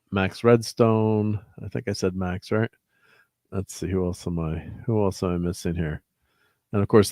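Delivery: ordinary talk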